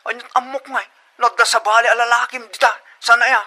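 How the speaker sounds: angry challenge. Acted. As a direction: throw the question at her.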